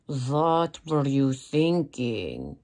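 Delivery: slow, drawn-out mock reproach; incredulous scolding. Model chiding herself over the story, incredulous scolding. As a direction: stretch each word out in disbelief. Recalls exchange with photographer, slow far voice.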